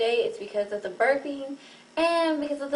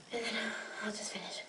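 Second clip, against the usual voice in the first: whispered; 14 dB quieter, faster than usual. Real, not acted. Quiet aside between burp attempts.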